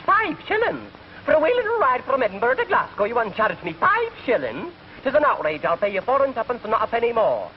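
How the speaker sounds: high, indignant Scottish brogue; outraged Scotsman. Acted. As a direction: squawk it out like a stingy Scot. Outraged Scotchman in joke.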